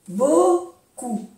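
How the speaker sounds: "Beaucoup" said as bo-koo, no P; silent final P. Shown as a demonstration. Teacher demonstrating French to learners, "Beaucoup" with silent P. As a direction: leave the P off the end of "Beaucoup". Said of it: "No se pronuncia la P".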